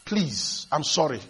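soft, low retold plea; subdued, imitated begging. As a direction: quietly beg for mercy. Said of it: "begging the boy".

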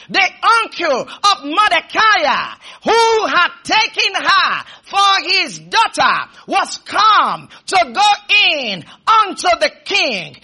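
slow, shouted scripture declamation; full-force preaching. Real, not acted. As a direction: thunder the verse phrase by phrase.

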